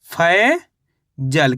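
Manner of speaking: "Faizal" very slow, stretched फै, long gap, then जल; syllable by syllable. Shown as a demonstration. Teacher reveals the test word to learners, slowly in two parts. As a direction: break "Faizal" after a long rising फै. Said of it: "तो मिलके बन गया ये".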